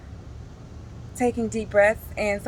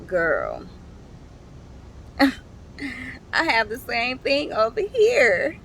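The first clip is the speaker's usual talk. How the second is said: crying; 5 semitones higher, much slower than usual. Real, not acted. Friend's words retold while crying.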